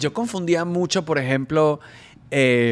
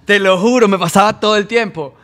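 emphatic; 5 semitones higher, 10 dB louder than usual. Real, not acted. Emphatic swearing it happened.

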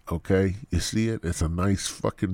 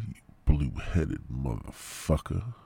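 ordinary talk

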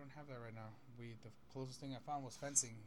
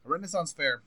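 loud; 4 semitones higher, 17 dB louder than usual. Real, not acted. Repeating for someone who misheard.

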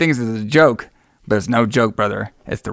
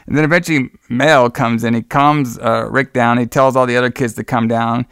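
normal talking voice

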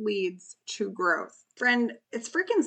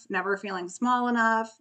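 usual speaking voice

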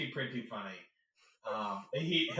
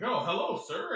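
higher, louder mock-polite greeting; a hammed-up stiff host voice; mock formal hello; imitating actor; 9 semitones higher, 5 dB louder than usual. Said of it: "and he's like".

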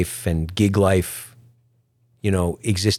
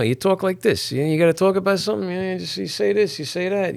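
mock mumbled lips; 9 semitones higher than usual. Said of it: "they're like".

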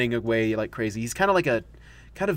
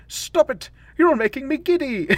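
much higher, a giddy squealing plea; dizzy Doctor protest; 12 semitones higher than usual. Retold or imitated. The Doctor spun into darkness by the Time Lords, squealing giddily. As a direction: squeal it while spinning out of control. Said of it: "And then he's like".

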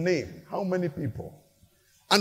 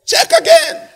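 high, loud mock disbelief; panicked protest; 11 dB louder than usual. Rejected soul protesting to the angel, high panicked shout. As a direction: shriek that the angel must look again.

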